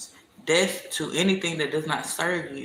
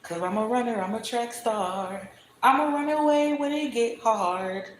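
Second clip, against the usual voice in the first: mock runaway sing-song; 7 semitones higher than usual.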